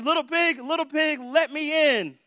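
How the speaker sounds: preacher hamming up the wolf at the door; big bad wolf. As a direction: call out to the pig like a hungry wolf. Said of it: "And the wolf says".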